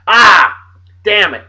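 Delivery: loud exclamation of annoyance; frustrated outburst. Real, not acted. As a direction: curse at your own slip-up. Realizes he forgot the picture.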